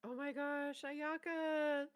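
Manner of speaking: high fangirl squeal of delight; excited. Real, not acted. Co-host greets a favourite character's reveal, excited. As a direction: gush with drawn-out delight, pitch way up.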